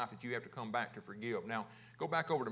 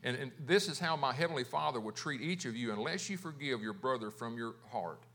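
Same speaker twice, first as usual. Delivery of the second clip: ordinary delivery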